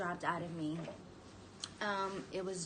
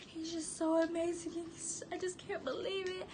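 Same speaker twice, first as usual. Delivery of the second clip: high, quivering voice close to tears; tearful joy; 7 semitones higher than usual. Retold or imitated. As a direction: relive the moment you first saw him, almost crying. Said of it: "I was like".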